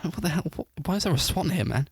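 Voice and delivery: high, baffled outcry; surprised. Retold or imitated. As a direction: pitch jumping up in disbelief. Reacting to swan on bed.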